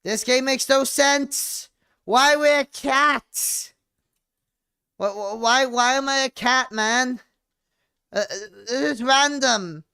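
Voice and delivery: high, loud, incredulous outburst; baffled yelling. Real, not acted. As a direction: yell at the game in total disbelief.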